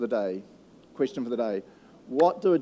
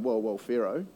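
lower, laughing nervous backing out; scared magicians bailing; 5 semitones lower, 5 dB quieter than usual. Acted. Magicians quitting on Pharaoh, nervous and laughing. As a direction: back away from the fight, laughing. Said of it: "the magicians themselves are going".

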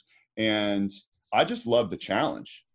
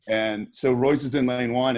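normal talking voice

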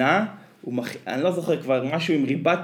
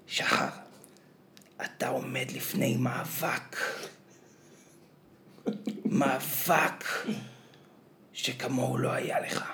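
slow, hushed epic build-up, close to a whisper; dramatic hushed narration; 6 dB quieter, slower than usual. Retold or imitated. Quoting the show's opening to a friend, in a slow, hushed, dramatic voice. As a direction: tease an omelette as if it were a great battle. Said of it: "הפרק הזה מתחיל ככה".

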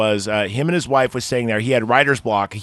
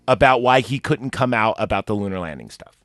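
ordinary talk

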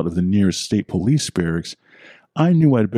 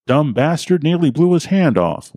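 put-on old man with accent; 9 semitones higher than usual. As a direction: crow it out like a tickled old local. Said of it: "laughed the old man with the accent".

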